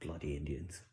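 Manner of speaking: low, muted British grumble; British manager. Retold or imitated. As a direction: imitate a British man grumbling under his voice. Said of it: "the British guy who runs the place going".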